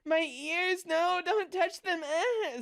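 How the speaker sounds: high-pitched whimpering plea; hammed-up whiny pleading with little cries; mock begging. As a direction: act out a helpless beg in a squeaky voice. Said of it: "start begging for mom and going".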